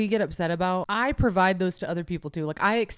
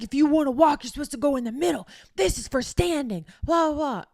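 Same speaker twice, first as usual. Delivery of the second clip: high-pitched mock scolding; imitated screaming; 8 semitones higher, 5 dB louder than usual. Imitating a woman telling a traveller off, mock screaming. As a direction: mimic a stranger yelling, tailing into blah-blah. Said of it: "Screams at me".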